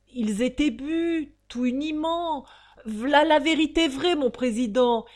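high, loud working-class witness; testifying wife. A narrator's reading. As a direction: plead the coached story to the judge. Coached wife testifies in court.

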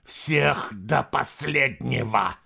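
slow emphatic repeat through gritted teeth. Acted. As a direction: press each word through clenched teeth. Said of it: "с нажимом повторил император сквозь стиснутые зубы".

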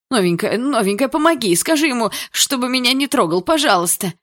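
high, loud, desperate begging; frightened pleading. A narrator's reading. Scared boy calls to the new girl for help, high pleading. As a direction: beg for help in a panic. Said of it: "закричал Есин и вдруг метнул на меня перепуганный взгляд".

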